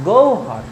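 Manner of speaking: গৌহর pronounced gou-har; correct pronunciation demo. Shown as a demonstration. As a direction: model গৌহর as two parts, gou then har. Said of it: "এটা উচ্চারণ করব". (গৌহর উচ্চারণে "গোউ-হর")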